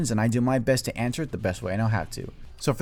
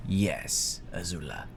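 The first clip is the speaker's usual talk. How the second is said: slow, low, hushed command; sinister Fire Lord father; 4 semitones lower, 5 dB quieter, slower than usual. Acted. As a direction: let each order sink in, slowing to the last word. Voices father giving sinister orders.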